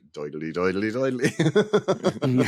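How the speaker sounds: mock stage-Irish diddly chant; exaggerated Irish. Irish speaker in America hamming up Irish, mock Irish lilt. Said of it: "You suddenly become much more Irish".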